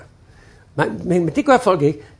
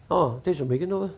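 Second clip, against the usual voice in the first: soft relieved reply; 4 semitones lower, 10 dB quieter, faster than usual. Retold or imitated. Softened customer reply.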